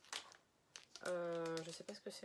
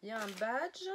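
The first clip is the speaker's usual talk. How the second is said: badge said louder with a teasing rise; playful repeat; 4 dB louder than usual. Real, not acted. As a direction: repeat it like a little tease, rising. Repeats the badge line twice.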